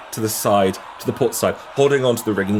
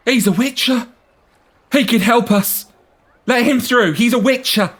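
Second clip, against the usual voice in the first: high excited shouting woman; woman calling out; 5 dB louder than usual. Acted. Woman in the crowd to everyone, high excited shout. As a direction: urge the crowd to let him through. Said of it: "a woman in the crowd who calls out".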